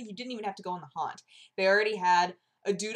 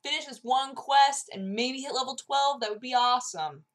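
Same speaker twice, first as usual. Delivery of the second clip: raised, hopeful pleading; retold eager bargaining; 6 semitones higher, 4 dB louder than usual. Players begging to finish one quest, eager. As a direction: push it higher, hoping hard for one more.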